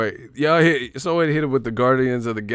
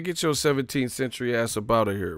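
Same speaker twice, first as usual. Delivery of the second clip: ordinary talk, nothing marked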